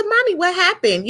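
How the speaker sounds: a hint of a scared little girl asking mom. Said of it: "You know, I'm a little girl".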